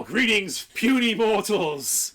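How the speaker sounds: high, loud, slow godlike boom; booming god voice. Pretend. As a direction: proclaim like a giant deity. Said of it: "It's like".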